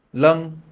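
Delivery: "lung" said high and loud with a clear vowel; Vietnamese word demo. Shown as a demonstration. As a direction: model "lung" for students to copy. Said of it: "Let's try a few more words".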